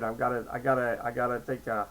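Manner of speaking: ordinary delivery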